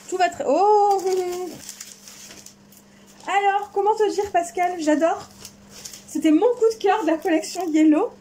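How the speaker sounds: voix aiguë qui s'emballe d'excitation; joie débordante. Real, not acted. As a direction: laisse la voix dérailler de joie. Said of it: "ça déraille un petit peu". (ravie devant son cadeau, voix qui monte d'excitation)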